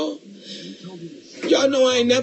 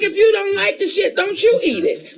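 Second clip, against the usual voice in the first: scolding mother, pitched up and louder; mock disgusted mother; 8 semitones higher, 5 dB louder than usual. Mother scolding her kid at the table, disgusted. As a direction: sneer it like a fed-up mom. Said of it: "she'd make the shit sound nasty".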